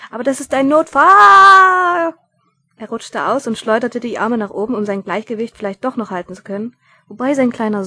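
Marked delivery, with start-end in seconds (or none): 0.1-2.1 very loud, high, drawn-out yell; shouting. A narrator's reading. Mumm yelling back that it's an emergency, very loud. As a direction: bellow it in full alarm. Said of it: "schrie er zurück".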